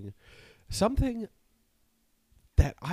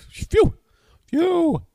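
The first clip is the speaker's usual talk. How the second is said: hammed-up loud relieved sigh; mock relieved phew; 11 dB louder than usual. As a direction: blow out relief, much higher and louder. Relief after guest answers.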